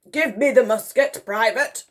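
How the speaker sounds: high, loud military order. A narrator's reading. Admiral taking the private's gun, ordering. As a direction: demand it like an officer. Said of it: "ordered the Admiral".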